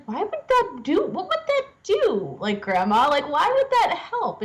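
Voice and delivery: high-pitched mock incredulous mom; mom's amused disbelief. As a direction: imitate a mother questioning in amused disbelief. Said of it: "his mom just starts laughing".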